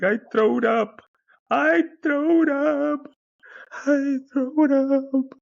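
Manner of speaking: very high, loud, drawn-out wail; crying toddler. Retold or imitated. Little nephew tells uncle he was sick, mock crying wail. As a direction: bawl it slowly like a small child in mock tears. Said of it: "He's crying his face off".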